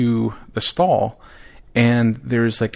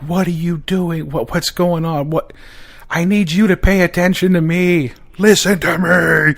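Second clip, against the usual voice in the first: frantic demanding; 7 semitones higher than usual. Retold or imitated. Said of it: "like a fucking crack addict".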